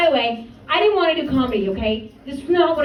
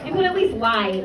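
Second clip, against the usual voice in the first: ordinary delivery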